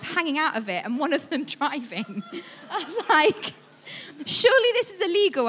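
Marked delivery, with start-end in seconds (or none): 4.3-5.5 higher, louder disbelieving exclamation; can't believe your eyes; amused disbelief at the teenagers, pitched up; amazed. Retold or imitated. Amazed at teens driving tractor.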